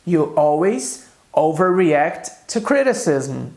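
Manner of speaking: slow, clear English example read for students; slow model sentence. Shown as a demonstration. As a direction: say each word slowly for the class. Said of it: "o que eu quis dizer aqui". (frase de exemplo lenta e clara)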